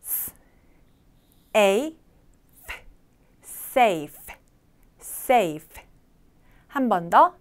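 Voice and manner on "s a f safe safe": "S", "A", "F" separately, then "safe" whole, lower; gentle final F. Shown as a demonstration. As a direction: end "safe" on a light, voiceless F. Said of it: "너무 강하지 않게 발음을".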